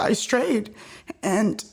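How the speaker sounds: usual speaking voice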